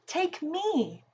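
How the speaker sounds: usual speaking voice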